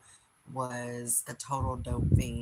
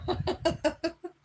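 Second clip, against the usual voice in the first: shrill high laugh, loud; high squealing laugh; 7 dB louder than usual. Real, not acted. Laughing after the call.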